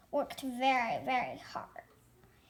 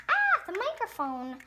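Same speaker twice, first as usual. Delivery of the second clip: normal talking voice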